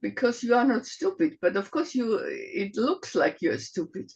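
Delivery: normal talking voice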